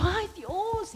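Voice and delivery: high, hushed little fright; nervous gasp. Retold or imitated. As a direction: gasp it like a scared teen. Vecina cae, ella asustada. (susto agudo y bajito)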